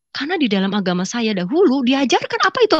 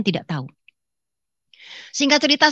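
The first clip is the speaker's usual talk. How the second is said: usual speaking voice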